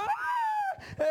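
very high imitated scream of a panicking kid; mock child's cry. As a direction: squeal like a child struggling in water. Imitating drowning kid's cries.

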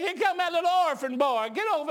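put-on high, loud call across to a child. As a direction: imitate excited folks hollering him over. Retelling folks calling over the orphan.

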